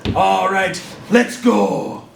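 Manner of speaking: usual speaking voice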